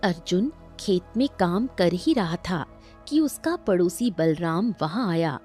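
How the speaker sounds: ordinary delivery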